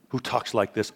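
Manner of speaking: ordinary talk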